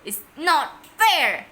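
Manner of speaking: loud, slow howl of protest. A narrator's reading. Henry sent to his room, howling. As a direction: drag out the protest at full volume. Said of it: "howled Henry".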